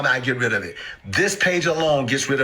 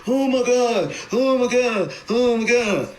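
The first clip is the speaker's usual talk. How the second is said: much higher, frantic oh my God; mock panic; 7 semitones higher, slower than usual. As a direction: ham up a panicking holder. Mocking daily portfolio checker.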